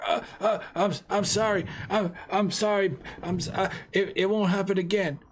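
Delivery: high, panicky, stammering plea; terrified pleading. Acted. Shopkeeper pleading with the gangster, high and terrified. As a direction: beg him to take the money and go.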